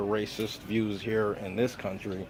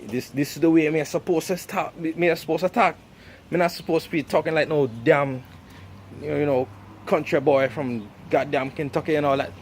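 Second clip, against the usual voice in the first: Jamaican accent; 2 semitones higher than usual. Shown as a demonstration. Showing how they're supposed to talk, Jamaican accent. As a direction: slip back into a Jamaican accent. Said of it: "slip back into my Jamaican accent".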